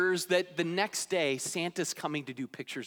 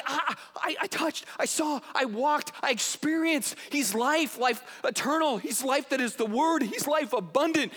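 higher, stumbling with enthusiasm; imitating eager John; 6 semitones higher than usual. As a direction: testify breathlessly, tripping over words. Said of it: "He's like".

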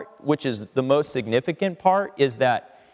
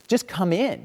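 mild plea, slightly raised; pleading father; 6 semitones higher than usual. Retold or imitated. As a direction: coax him gently to come inside. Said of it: "the father pleads with him and says".

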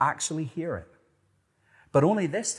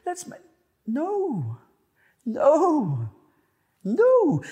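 rising, louder no's, each harder; emphatic no; 8 dB louder, much slower than usual. Real, not acted. Preacher cutting himself off, emphatic rising no. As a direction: slam each no down.

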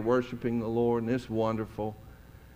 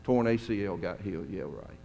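low, quiet, dismissive mutter; skeptical inner voice; 5 semitones lower, 7 dB quieter than usual. Retold or imitated. Pastor doubting the greeter's story, quiet sarcasm. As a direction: roll your eyes at it under your breath. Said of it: "And I was thinking".

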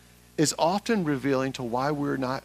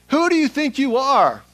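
loud, high outburst; indignant challenge; 11 semitones higher, 9 dB louder than usual. Acted. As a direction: fire the question out in outrage. Acted challenge to Jesus, repeated refrain.

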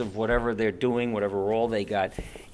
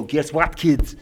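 lightly goofy proud dad voice, a bit higher; goofy clueless sitcom voice; 4 semitones higher, 4 dB louder than usual. Retold or imitated. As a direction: play a dopey dad bragging to his kids. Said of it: "daddy can come home and go".

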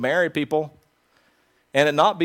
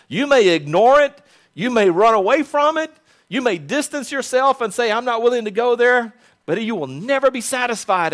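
rising preacher fervor; 6 semitones higher than usual. Real, not acted. Preaching climax.

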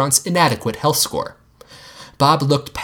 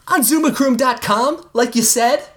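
put-on much higher eager kid voice; boy cheerfully answering his dad; kid shouting; 12 semitones higher than usual. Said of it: "Jean shouted".

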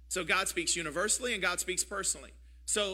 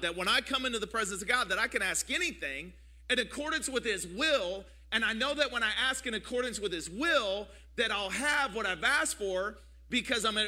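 louder, higher preacher's build, hammering each line; full force preaching; 3 semitones higher, 4 dB louder than usual. Acted. Pastor urging his church to hold faith, building in force. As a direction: ride the rhythm up to a shout. Preaching climax, rising intensity.

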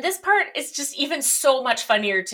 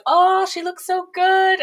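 swooning; 9 semitones higher, 5 dB louder than usual. Retold or imitated. Girls admire teacher.

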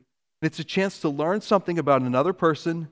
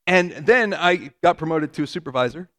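usual speaking voice

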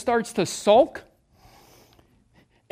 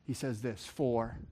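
much softer, lower reverent delivery; hushed preaching; 10 semitones lower, 7 dB quieter than usual. Acted. Preacher solemnly introducing the verse to the church. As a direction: draw them in with a quiet, solemn tone.

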